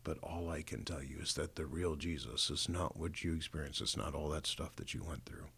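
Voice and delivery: hushed, tearful retelling; soft, half-whispered apology, imitating a hurt mother; through tears. Mom apologising to her son for what happened, quietly tearful. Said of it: "she, through tears, she said".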